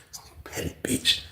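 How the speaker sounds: hushed insult under the breath; whispered grumbling. Retold or imitated. Inmate complains to roommate about cellmate, whispered grumbling. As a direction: whisper it so he can't hear. Retold muttered talk with roommate.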